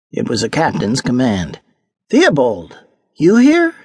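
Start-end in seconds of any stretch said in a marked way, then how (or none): none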